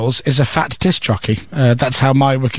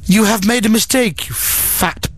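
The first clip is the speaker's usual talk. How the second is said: higher mock sat-nav woman, disdainful; snotty sat nav; 5 semitones higher than usual. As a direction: insult the driver as a snooty navigation voice. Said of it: "sat nav is a snotty cow".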